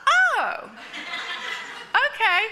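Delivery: high, loud laughing oh, okay; sheepish realization. Retold or imitated. Wife realizing the praise was for the teaching, high laughing oh. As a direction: catch on with embarrassed amusement.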